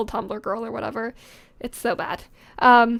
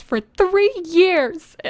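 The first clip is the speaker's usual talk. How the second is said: "three years" pushed high and emphatic; all-caps emphasis; 5 semitones higher, 3 dB louder, much slower than usual. Acted. Heroine lamenting her time with Josh, "three years" exclaimed. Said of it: "All caps and exclamation point".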